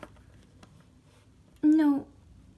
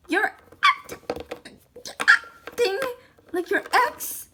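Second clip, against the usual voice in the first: mocking mimic; 8 semitones higher than usual. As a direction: parrot the son back teasingly. Mom mimics son's complaint.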